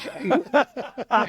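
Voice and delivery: high, loud laugh bursting out; real laugh breaking the telling; burst of laughter. Real, not acted. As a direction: crack up at your own story. Laughs at his own story.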